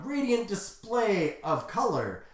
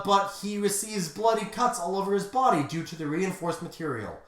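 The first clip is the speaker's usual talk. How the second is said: ordinary delivery, nothing marked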